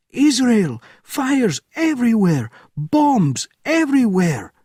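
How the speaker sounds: very high, loud cries; panicked screaming. Acted. Officer screaming the reports, very high and loud. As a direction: yell each line in terror.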